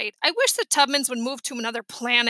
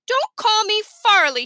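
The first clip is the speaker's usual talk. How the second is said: put-on high, louder little-kid protest; protesting little boy; 8 semitones higher, 5 dB louder than usual. A narrator's reading. Fudge refusing his real name, indignant. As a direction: object sharply as an offended small boy.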